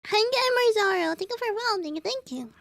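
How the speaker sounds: high, cheery thank-you; bright greeting. Real, not acted. Streamer greeting a new follower, high and cheery.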